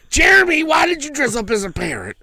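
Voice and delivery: loud, high, exasperated yell; scolding parent. Retold or imitated. Parent yelling at Jeremy for the parrot suit. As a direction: shout at Jeremy, high and cross.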